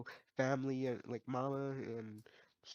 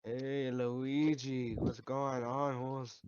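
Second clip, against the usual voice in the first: slow, drawn-out mock Mexican Mario accent, louder; Mexican Mario impression; 4 dB louder, much slower than usual. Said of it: "impression of Mexican Mario".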